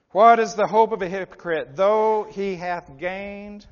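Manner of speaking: loud scripture proclamation, slowing at the end; forceful preaching. Real, not acted. Preacher putting a scripture question to hypocrites, forceful preaching. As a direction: ring the question out from the pulpit.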